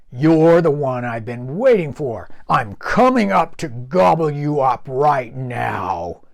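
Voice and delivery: loud, greedy troll menace; troll threat. Acted. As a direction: gloat over your next meal.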